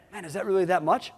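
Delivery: quietly doubting, is it really that much. Retold or imitated. Colleague doubting the ad budget, softly asking. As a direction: ask it softly, half unsure. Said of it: "Someone said to me the other day".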